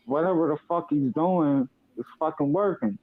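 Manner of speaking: ordinary talk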